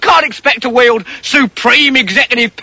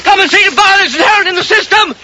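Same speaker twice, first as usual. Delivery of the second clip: high hammed-up cry for help; mock alarm, calling to passers-by; 7 semitones higher than usual.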